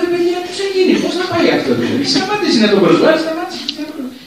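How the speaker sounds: very high-pitched mock pleading; retold peacemaker's protest, voice shooting far up; exasperated intervention. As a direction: act out breaking up a silly quarrel, high and amused. Acts out himself intervening in quarrel.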